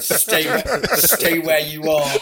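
slow, loud, laughing "stay where you are"; laughing mock voice. Legs answer the brain, slow and laughing. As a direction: laugh through the legs' reply. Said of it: "and my legs said".

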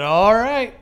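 high, loud, drawn-out rising reply; loud mock acceptance. Customer answering cashier's last fact, loud and high. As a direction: belt it out as a baffled send-off.

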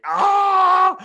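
high, loud cry of frustration; anguished yell. Retold or imitated. Getting bad news on the phone, a loud high anguished cry. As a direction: let out a sharp, pained wail.

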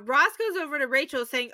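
ordinary talk, nothing marked

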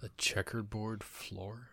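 whispering. Real, not acted. Puzzled aside while watching.

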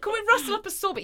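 high, fast laughing call. Real, not acted. Joking to the room about the wedding sorbet, high and laughing.

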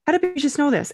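put-on a high, amazed question to the baby. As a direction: marvel at her, pitch jumping up. Amazed by baby's first wave.

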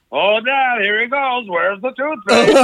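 high teasing singsong, slowed on the last line; mocking kids. Kids teasing their dad about his temper, mocking singsong. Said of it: "the children are all like".

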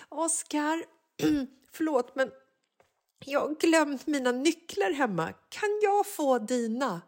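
high, meek asking voice; sheepish plea. Retold or imitated. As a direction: beg your son for his keys. Mother begs son for keys. (ödmjuk bön till sonen, hög röst)

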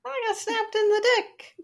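high squeaky pained Manly; mock Manly. Friend voices Manly caught by the mousetrap, high squeal. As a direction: squeal it like a hurt cartoon husband.

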